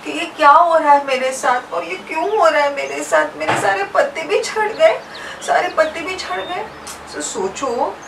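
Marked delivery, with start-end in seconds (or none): 0.0-6.6 mild crying. Acted. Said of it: "पलाश तो बहुत रोने लगा".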